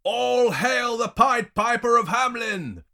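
very high, drawn-out crowd jeer; loud, slow mock cheer; jeering shout. A narrator's reading. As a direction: hail him mockingly at full shout. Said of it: "the townspeople jeer from the streets".

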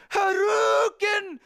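high, loud, drawn-out shout; battle cry. Acted. Reader cheering the headbutt, drawn-out battle yell. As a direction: yell it like a video game special move.

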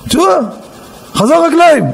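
high, excited exclamation; retold amazement. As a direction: burst out with the good news. Legs suddenly come back, retold. (בהתפעלות ובהתרגשות)

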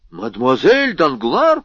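ordinary delivery, nothing marked

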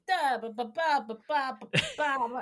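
mock Buenos Aires Italian cadence; Italian-style Buenos Aires rhythm. Shown as a demonstration. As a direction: bounce out the porteño lilt on da ba ba. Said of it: "That's the rhythm".

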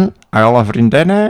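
nosy relative. Retold or imitated. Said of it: "Ik moest weer dezelfde vraag".

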